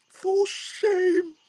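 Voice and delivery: very high, drawn-out scolding; hammed-up falsetto scold breaking into a laugh; exaggerated mock shaming. Onlooker judging his mirror talk, hammed-up shaming.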